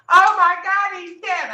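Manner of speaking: high, loud panicked shriek; retold terrified scream that fades on the last words; mock scream. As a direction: imitate a girl screaming over a body. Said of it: "a girl screaming in my face".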